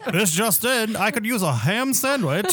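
high, hammed-up bulletin delivery; mock vintage radio announcer; old-timey newsman voice. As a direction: announce a trifle as breaking news. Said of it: "all in that old-timey voice".

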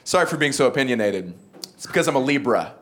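ordinary delivery

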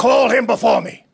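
high, loud peremptory order; angry command. Acted. Captain demands the sailor from skipper, angrily commanding. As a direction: pull rank loudly.

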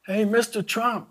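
high, slow call across a ramp. Retold or imitated. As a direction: hail him from above, stretched out. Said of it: "and I yelled out".

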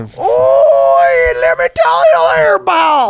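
shouted high mock Canadian accent; an exaggerated Canadian accent, shouted. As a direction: bark it like a furious Canuck. Mock Canadian outburst again.

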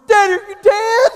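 put-on high-pitched child calling out; son yelling. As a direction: call from the porch like a kid. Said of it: "our son yells from front porch".

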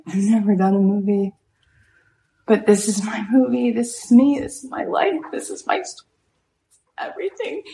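high tearful voice breaking into whisper; imitated bursting into tears. Oprah tells Spielberg this is her movie, imitated tears. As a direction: act overcome, squeaking up till the words give out. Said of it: "she just burst into tears".

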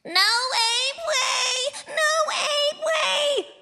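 put-on high, drawn-out toddler wail; toddler screaming. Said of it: "she is yelling at the top of her lungs".

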